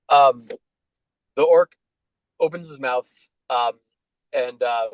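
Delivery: normal talking voice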